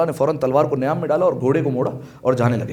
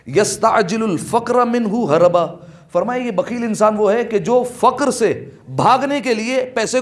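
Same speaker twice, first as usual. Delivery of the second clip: impassioned preaching; 5 semitones higher than usual. Acted. Preacher warning misers they invite poverty, emphatic. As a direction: drive home the warning about misers.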